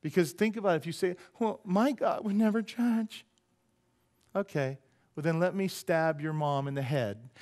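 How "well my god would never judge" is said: higher, drawn-out protest; slow, lightly mocking naysayer; mock objection. Someone objecting that God would never judge, mock protest. Mimics someone denying judgment.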